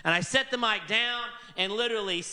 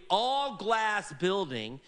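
ordinary talk, nothing marked

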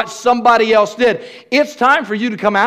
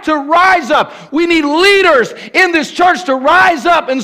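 high, loud shouted preaching, slowing on key lines; preaching at full force; 7 semitones higher, 4 dB louder than usual. Acted. Pastor calling church leaders to rise up, shouted preaching. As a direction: whip the room up to rise.

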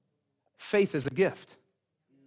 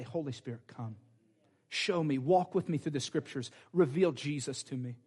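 soft, inward prayer to the Spirit; hushed prayer; 4 semitones lower, 6 dB quieter than usual. Shown as a demonstration. Pastor shows how he opens his Bible, hushed prayer. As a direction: pray it gently under the breath.

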